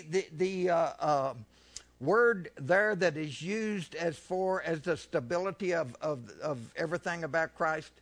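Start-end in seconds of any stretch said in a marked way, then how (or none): none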